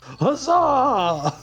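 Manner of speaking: high cheering cry with a laugh. Acted. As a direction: whoop it like a happy crowd. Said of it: "resounding single cheer".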